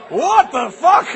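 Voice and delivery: loud, high, drawn-out disbelief; explode, stretching every word; outraged shout. Acted. Principal hears the word, outraged yell. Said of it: "And the principal's like".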